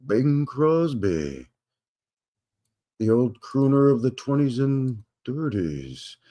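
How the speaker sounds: put-on drawn-out, swooping old crooner. Host introducing the film's narrator, bad crooner impression. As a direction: do a hammed-up crooner, stretching the words. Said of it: "that's a terrible [name] impression".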